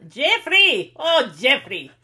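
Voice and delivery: sassy maid calling out, exasperated and loud; loud, mock-scolding cry to the boss; Zoila. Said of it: "That was my Zoila impression".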